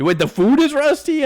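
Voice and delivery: sudden high disbelieving question; incredulous high pitched wait; surprised. Real, not acted. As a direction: caught off guard, voice jumps up. Surprised at chat remark.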